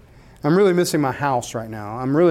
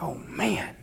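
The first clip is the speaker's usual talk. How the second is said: whispered oh man; 9 dB quieter than usual. Retold or imitated. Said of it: "It's like".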